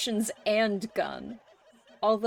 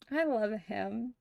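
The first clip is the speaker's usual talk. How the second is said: ordinary talk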